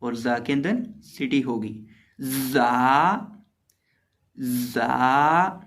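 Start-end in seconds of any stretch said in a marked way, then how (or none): 2.5-5.6 जा drawn out with a hissing whistle; letter demo. Shown as a demonstration. Teacher drilling a student on the letter, hissing जा. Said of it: "सीन के अंदर भी सिटी की आवाज़ पैदा होगी".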